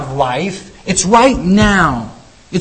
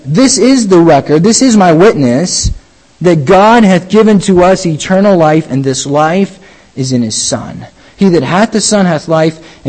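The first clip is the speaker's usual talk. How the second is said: scripture reading; 4 semitones lower than usual. Acted. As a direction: proclaim the verse like holy writ. Reads verse eleven aloud.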